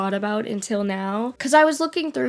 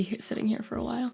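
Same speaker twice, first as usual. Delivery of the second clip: soft whispered aside; 10 dB quieter, much faster than usual. Real, not acted. Vlogger warning viewers she'll be here a while, whispered. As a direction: breathe it like a secret aside.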